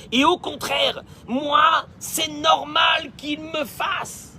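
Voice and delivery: high, loud and outraged, building to a slow shout; entitled rant that ends in a dragged-out shout; indignant demanding spouse. Acted. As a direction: play the spoiled spouse who thinks everyone owes them. Voices a self-centred demanding spouse.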